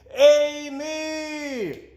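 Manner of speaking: high yell up the stairs; loud yell. A narrator's reading. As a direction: call her name at full voice. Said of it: "yelled as loudly as he could".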